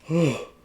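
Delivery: long loud yawn; big loud yawn. Real, not acted. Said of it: "these yawns are gonna be the death of me".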